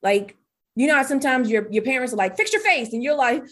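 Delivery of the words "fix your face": much higher mock parent telling you off; lightly mocked parent scolding. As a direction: imitate a parent snapping at a sulky kid.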